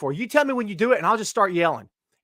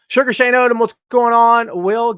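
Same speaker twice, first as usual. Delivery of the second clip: calling out; 3 semitones higher, 10 dB louder than usual. Real, not acted. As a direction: hail him like he just walked in. Calling out a viewer's name.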